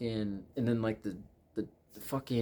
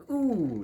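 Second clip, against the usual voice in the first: high, loud mock-spooked ooh; playful viewer's ooh of dread; 10 semitones higher, 5 dB louder than usual. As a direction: let out a high, drawn ooh. Acting a spooked viewer's reaction.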